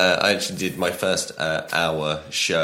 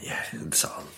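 whispered; 9 dB quieter than usual. Real, not acted. Mocking the vague article.

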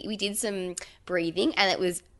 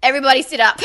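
laughing instruction; 8 semitones higher, 11 dB louder, much faster than usual. Retold or imitated.

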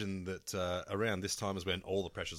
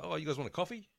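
friendly bogan offer; 7 semitones higher, faster than usual. Retold or imitated. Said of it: "He's like".

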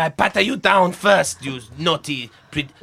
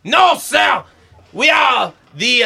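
shouting; 4 semitones higher, slower than usual. Acted. Guard answering firmly.

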